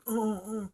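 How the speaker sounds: imitated high, soft wordless grunts; mute grunts. Girl who can't talk answering Jessica's questions, mute grunts. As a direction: grunt softly as if unable to talk. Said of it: "the girl can't talk".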